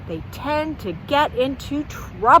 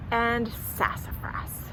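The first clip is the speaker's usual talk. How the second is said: whisper; 10 dB quieter than usual. Acted. Whispered end of title.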